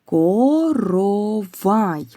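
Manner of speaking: Коровай drawn out syllable by syllable, ко-ро-вай; slow dictation. Shown as a demonstration. Teacher presents the next word slowly for sound analysis. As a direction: stretch every syllable of Коровай. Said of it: "Наступне слово".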